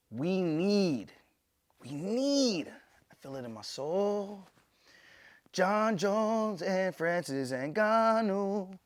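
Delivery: high, loud, drawn-out proclamation; hammed-up plea. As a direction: stretch each word out like a soulful fight call. Hammed-up call for a dream fight.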